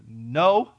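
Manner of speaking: NO said loud and firm; emphatic no. Retold or imitated. Answering whether he is from the South, emphatic no. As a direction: hit "No" hard as a single emphatic word.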